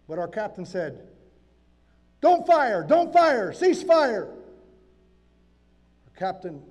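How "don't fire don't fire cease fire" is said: loud, high, urgent captain's order; officer calling a ceasefire; shouted command. Acted. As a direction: shout to stop your men firing. Captain ordering men to hold fire.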